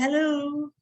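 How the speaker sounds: high, drawn-out acted hello; answering the phone. Mom answers phone in story.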